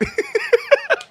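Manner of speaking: high wheezy run of laughter; sustained high-pitched laugh. Real, not acted. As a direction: crack up in a high giggle. Laughs at his own bit.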